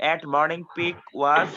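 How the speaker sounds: very slow, left hanging on a rise; slow dictation. Real, not acted. Teacher repeats the dictated line. (খুব আস্তে dictation দেওয়া)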